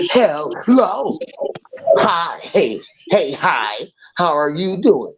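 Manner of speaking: high, sing-song phony hello; mock fake greeting. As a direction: imitate a fake friendly welcome. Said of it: "but they say".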